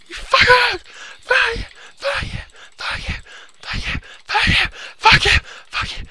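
high, squeaky repeated swearing; hammy squealed fury; strained cursing. Pretend. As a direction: spit it out again and again in falsetto.

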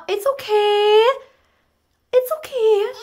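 put-on calm the crying baby; mild mock tearful comfort; soothing.

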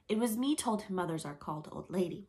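ordinary delivery

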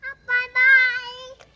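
loud slow farewell shout; calling goodbye. Real, not acted. Little girl shouting a slow loud goodbye to her snail. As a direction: call it out slowly, loud.

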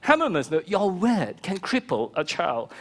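normal talking voice

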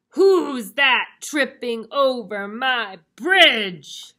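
roaring, slow, very loud troll. Acted. Troll challenges the second goat, roaring. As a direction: bellow it with long drawn-out words. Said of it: "Troll voice".

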